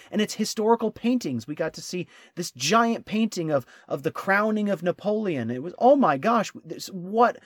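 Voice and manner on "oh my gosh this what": high, loud retold astonishment; starstruck amazement. Traveler recognizing a giant famous painting, amazed. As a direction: tumble over yourself in reenacted awe.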